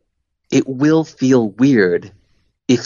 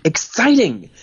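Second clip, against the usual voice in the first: pushy boss; 11 semitones higher than usual. Retold or imitated. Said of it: "my boss would be like".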